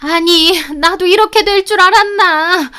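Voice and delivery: wife squirming before her husband; flustered excuse. A narrator's reading. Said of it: "쩔쩔매더니".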